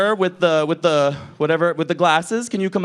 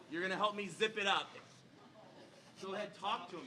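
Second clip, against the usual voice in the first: usual speaking voice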